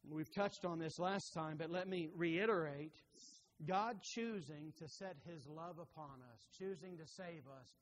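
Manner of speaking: ordinary talk, nothing marked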